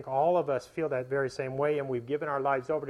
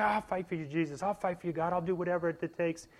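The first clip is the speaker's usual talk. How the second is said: higher, lightly voiced eager vow; a touch of rash zeal, pitched up; eager promise; 4 semitones higher than usual. Preacher mimicking his own eager promise to Jesus, mildly. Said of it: "I say".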